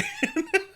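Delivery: squealing laugh; laughing at the cop; high giggle. Real, not acted.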